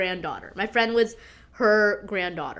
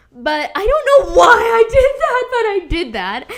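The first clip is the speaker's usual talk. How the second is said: embarrassed wail; 10 semitones higher, 9 dB louder than usual. Real, not acted. Embarrassed about loving her son.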